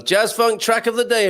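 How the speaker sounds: high, loud segment announcer; jingle-style announcement. Pretend. Host introduces the daily track feature, announcer-style. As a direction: belt out the feature title like a jingle.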